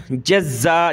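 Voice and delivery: जज़्ज़ा with a doubled z, "jaz-za"; doubled consonant. Shown as a demonstration. Teacher imitating a common misreading, doubled z in जज़्ज़ा. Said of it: "जज़्ज़ा नहीं पढ़ेंगे".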